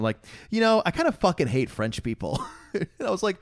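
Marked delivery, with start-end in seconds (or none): 0.5-2.4 deadpan. Retold or imitated. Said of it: "just dead, dead straight, straight deadpan to him".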